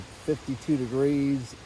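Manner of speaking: normal talking voice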